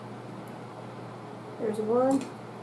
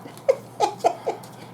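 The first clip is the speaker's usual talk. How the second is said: laughing; 9 semitones higher than usual. Real, not acted. Burnt by hot granola.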